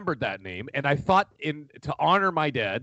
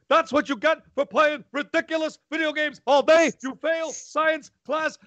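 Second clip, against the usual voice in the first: high-pitched mock tirade, slowing on the final verdict; hammed-up punishing dad voice; angry dad; 14 semitones higher, 3 dB louder than usual. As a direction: rant like a cartoonishly furious father. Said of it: "when he got angry".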